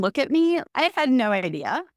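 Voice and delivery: usual speaking voice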